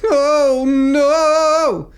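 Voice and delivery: high, loud wailing oh no; stolen cake cry. Acted. Large man finds his cake gone, wailing. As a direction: wail like your cake just vanished. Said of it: "the stolen cake cry".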